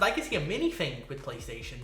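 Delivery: ordinary delivery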